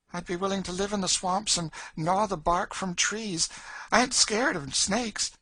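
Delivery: put-on high, eager girl's voice. A narrator's reading. Girl longs to escape to the swamps, eager and high. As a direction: press the case eagerly, pitched well up. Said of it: "said Emmeline eagerly".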